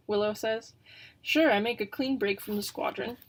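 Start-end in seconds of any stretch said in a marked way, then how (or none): none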